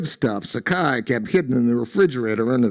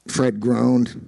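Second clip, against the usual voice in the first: usual speaking voice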